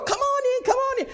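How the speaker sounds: high, sing-song welcome at the door; hostess greeting. Retold or imitated. Sheila welcoming the preacher at the door, high and sing-song.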